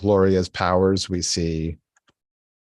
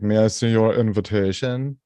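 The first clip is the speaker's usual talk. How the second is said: snooty waiter. Retold or imitated. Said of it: "Ew, that's so gauche".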